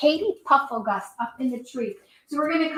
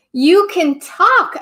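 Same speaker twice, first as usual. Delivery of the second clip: higher, louder and slow in wonder; amazed exclamation; 5 semitones higher, 11 dB louder, much slower than usual. Acted. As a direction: gasp it out in amazement. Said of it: "exclaimed Katie".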